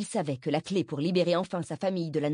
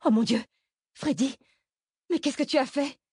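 high-pitched, aghast exclamation; horrified mother; 10 semitones higher, 4 dB louder than usual. A narrator's reading. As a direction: cry out in shock at the sight. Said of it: "remplacée par un air horrifié". (mère horrifiée, voix aiguë)

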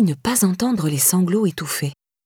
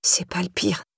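barely audible whisper; 4 dB quieter than usual. A narrator's reading. Ashamed Laura confessing to Irène, whisper. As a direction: breathe it out in a whisper. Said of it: "la voix à peine plus audible qu'un murmure".

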